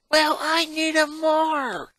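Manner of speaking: slow, high, loud snappy comeback; retort. Acted. Twin fighting her sister over the brother, loud slow retort. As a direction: throw it back hard and slow. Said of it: "her Lana retorted".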